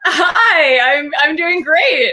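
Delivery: giggling through the greeting; laughing. Real, not acted. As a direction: laugh while saying hi. Guest greets host, laughing.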